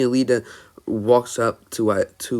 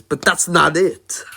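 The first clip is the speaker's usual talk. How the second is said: All Might; 6 semitones higher, 6 dB louder than usual. Acted. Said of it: "But All Might says".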